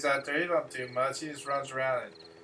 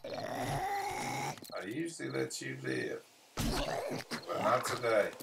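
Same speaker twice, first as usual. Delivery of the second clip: mock villain threat; 2 semitones lower, 7 dB quieter, much slower than usual. Gamer taunting his zombie friend Fred, slow mock menace. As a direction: play it like a movie villain.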